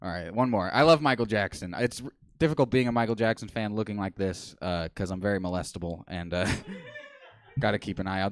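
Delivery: usual speaking voice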